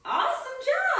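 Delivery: bright, high burst of praise; excited praise. Real, not acted. Teacher cheering on her class with excited praise. Praising kids after volcano breath.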